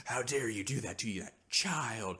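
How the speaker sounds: hammed-up losing it; mock indignant outburst; Trip. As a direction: scold with exaggerated outrage. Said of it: "Trip just kinda loses it".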